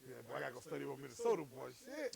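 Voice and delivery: low, fast mock grumble; a dropped-down joking voice mocking the rookie; mock dismayed rookie. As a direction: mumble it low like a worried rookie. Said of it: "you gonna be like".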